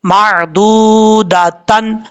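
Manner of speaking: मादूदातन slowly with full tan ending; connected reading. Shown as a demonstration. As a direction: draw out मादूदातन with tanween. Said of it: "तो कैसे पढ़ा जाएगा?".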